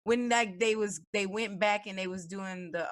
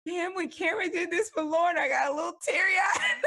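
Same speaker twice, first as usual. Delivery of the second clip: mock emotional shout; 10 semitones higher than usual. Friend imitating them both moved by Love Is Blind. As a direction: yell it like a teary fan. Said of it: "me and you was like".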